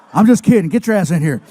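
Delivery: loud gruff wrestler teasing; Stone Cold voice. Retold or imitated. Wrestler hurrying the comedian inside, loud gruff teasing. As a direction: bark it like a tough guy joking around.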